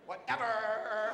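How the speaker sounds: put-on very slow, drawn-out teenage whatever; teen attitude. Shown as a demonstration. Showing the audience a young teen girl's attitude, slow and dismissive. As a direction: give it full eye-roll teen disdain. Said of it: "It kinda looks like this".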